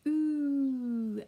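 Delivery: long, loud "Ooh" with rounded lips; "Ooh" sustained and projected; drawn-out "oo". Shown as a demonstration. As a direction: hold "Ooh" as a phonics sound. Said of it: "we're gonna do this".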